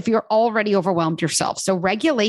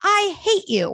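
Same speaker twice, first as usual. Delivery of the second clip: mock child outburst; 11 semitones higher, slower than usual.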